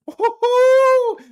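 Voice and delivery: very high, loud, drawn-out woo; whooping cheer for Louis Sutherland; hype shout. Real, not acted. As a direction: cheer the fighter in like a crowd. Hyping fighter walkout.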